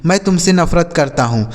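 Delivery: ordinary delivery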